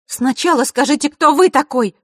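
высокий громкий дерзкий голос девочки; требуй громко и дерзко. A narrator's reading. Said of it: "громко произнесла Василиса". (громкое требование)

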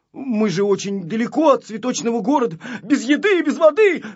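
high, loud lament, climbing; acted panic, pitch rising to the end; distressed pleading. A narrator's reading. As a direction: plead your plight with mounting alarm. Stranded boy pleads their desperate plight.

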